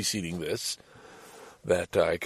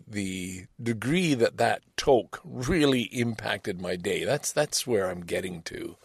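ordinary delivery

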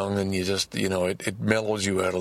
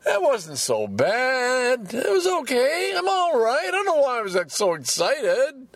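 very high, drawn-out singsong relief; hammed-up giddy reassurance; relieved later self. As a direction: squeal it out, all worries over. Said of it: "I'm sure I'll be saying".

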